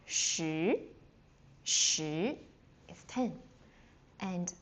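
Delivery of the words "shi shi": shi rising from low. Shown as a demonstration. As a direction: lift shi upward like a question. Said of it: "Going up".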